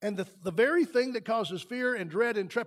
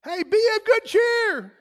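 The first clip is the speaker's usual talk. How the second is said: slow, much higher and louder cheery call; voicing Jesus; 13 semitones higher, 10 dB louder, slower than usual. Preacher voicing Jesus calling to frightened disciples, higher and louder. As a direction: call it out bright and reassuring, slowly. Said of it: "what did he say".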